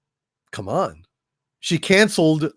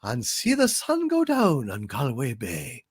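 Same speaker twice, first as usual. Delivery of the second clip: put-on slow, crooning Irish accent; sing-song Irish lilt, slowly; 5 semitones higher, slower than usual. Asked by a viewer for an Irish accent, slow Irish lilt. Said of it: "Do your Irish accent, please".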